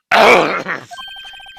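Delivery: loud, hammed-up throat clear; over-the-top throat clearing. Pompous lawyer announcing himself with a hammed-up throat clearing. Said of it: "most over-the-top clearing of the throat".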